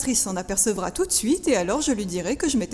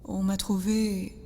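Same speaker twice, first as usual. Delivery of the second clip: subdued confession; 4 semitones lower, 5 dB quieter than usual. Acted. Confides being a lost orphan.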